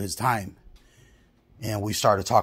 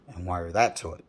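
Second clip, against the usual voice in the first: ordinary talk, nothing marked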